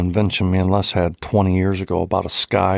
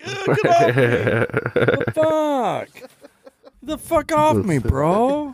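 much higher, squeaky put-on complaint; mock protest; 12 semitones higher, slower than usual. Friend acts out the losing game, high-pitched mock protest. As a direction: imitate someone objecting in a high voice.

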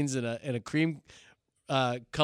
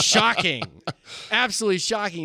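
mock shock; 7 semitones higher, 4 dB louder than usual. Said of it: "mock reaction".

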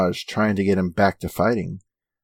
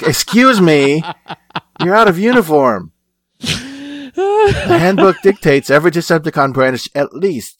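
high, loud scolding over a dress code; pitched-up, pompous reprimand; indignant boss; 8 semitones higher, 4 dB louder than usual. Acted. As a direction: play a huffy, officious commander. Acting Megatron scolding Skywarp.

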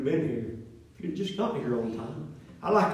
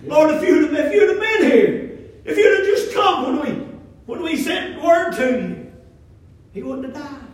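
raised, loud, reproachful complaint; resentful Martha; 11 semitones higher, 9 dB louder than usual. Retold or imitated. Martha confronting Jesus, raised and reproachful. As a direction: throw her grief back at him. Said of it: "the exact same words to Jesus".

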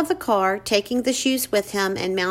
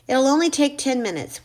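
ordinary delivery

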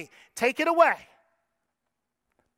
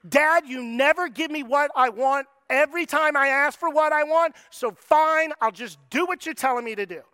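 louder, exasperated complaint to dad; utter frustration; 3 semitones higher, 5 dB louder than usual. Retold or imitated. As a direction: huff it out, fed up. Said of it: "complete and utter frustration".

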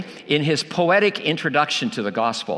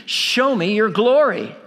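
higher-pitched plea, quoting Moses; mild imitated cry; 5 semitones higher than usual. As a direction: cry it out as Moses, without shouting. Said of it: "Moses cries out".

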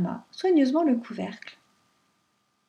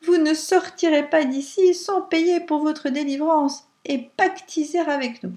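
loud, gleeful, higher taunting; joyful shout; 6 semitones higher, 5 dB louder than usual. A narrator's reading. Pierrot gleefully shouts at captured Rain. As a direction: crow with triumph over the captive. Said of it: "cria joyeusement".

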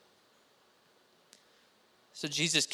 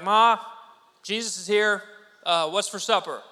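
loud, higher call through the house; 4 semitones higher, 8 dB louder than usual. Pretend. A kid bringing Jesus home calling to his mom, loud playful call. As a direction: holler it playfully across the house.

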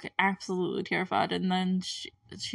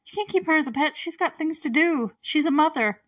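high, pleading mock protest; indignant protest; 7 semitones higher, 4 dB louder than usual. Viewer protesting to the boy on screen, high and indignant. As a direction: argue with the kid on screen. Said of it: "And it's like".

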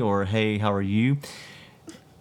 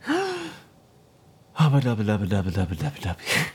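silly gibberish. Pretend.

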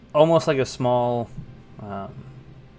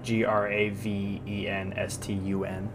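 slow letter-by-letter spelling; measured, letter at a time; 5 dB quieter, slower than usual. Shown as a demonstration. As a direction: spell each letter out slowly. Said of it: "Gravenstun, sorry".

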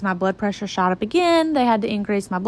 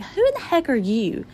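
mom's startled question; 5 semitones higher than usual. Retold or imitated. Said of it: "my mom was like".

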